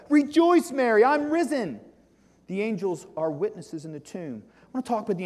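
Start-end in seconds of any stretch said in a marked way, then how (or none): 0.1-1.8 high, louder burst of joy on Rejoice; joyful proclamation. Acted. Preacher voicing Jesus telling Mary he is risen, jubilant. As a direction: announce the resurrection like thrilling news.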